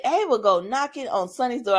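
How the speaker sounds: usual speaking voice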